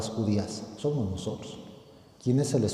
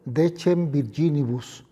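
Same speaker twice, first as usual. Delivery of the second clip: raised, solemn gospel proclamation; liturgical proclamation; 6 semitones higher, 5 dB louder than usual. Acted. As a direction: proclaim it like a priest at the altar. Latin gospel proclaimed at Mass.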